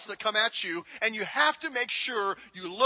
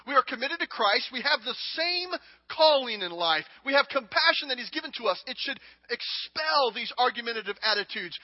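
ordinary talk, nothing marked

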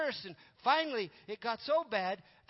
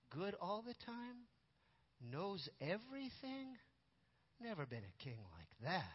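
slow, soft, wondering doubt; quiet disbelief; 10 dB quieter than usual. Retold or imitated. Little king questions advisors' perfect king, quiet disbelief. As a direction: echo it slowly and softly, weighing each claim.

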